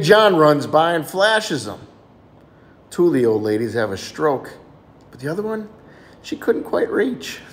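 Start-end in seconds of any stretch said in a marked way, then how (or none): none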